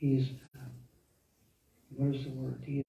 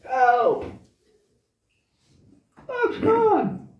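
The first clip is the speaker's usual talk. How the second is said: high-pitched acted cry for help; Ram; 3 dB louder, much slower than usual. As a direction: call out high, as if yelling from far away. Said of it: "they hear Ram yelling".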